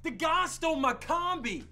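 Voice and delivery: loud, slow, high outrage; outraged. Acted. Man shouting at police that his van was stolen, slow and loud. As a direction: hammer each word in disbelief.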